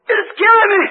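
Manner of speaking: high, loud, crying plea to God; retold terrified scream. Preacher reliving the vision, screaming to God in terror. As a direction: cry out in terror as the vision ends.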